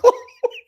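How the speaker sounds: high, loud burst of laughing; real laughter. Real, not acted. Host laughing at Eight Mile comment, high loud laugh. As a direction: crack up suddenly.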